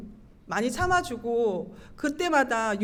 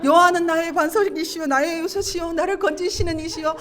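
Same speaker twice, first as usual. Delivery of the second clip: raised chant-like recital climbing to a cry; fervent praise; 5 semitones higher, 8 dB louder than usual. Retold or imitated. Upset wife praising God to be rescued, fervent pleading. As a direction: praise urgently, ending very high and loud. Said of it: "막 찬양을 하는데".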